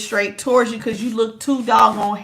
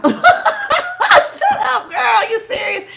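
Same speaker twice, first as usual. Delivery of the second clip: high laughing squeal of disbelief; much slower than usual. Acted. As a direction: cackle it out, much higher. Mocking the love bomb message.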